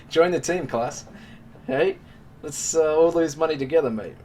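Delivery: loud, high, hammed-up cheer; rally the losing traders like a mock coach; mock rally cry.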